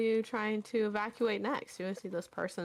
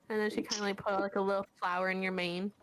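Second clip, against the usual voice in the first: usual speaking voice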